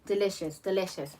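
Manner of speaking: soft, low, lingering; savoring whisper. Real, not acted. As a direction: murmur it like a treat. Savoring fried Oreos.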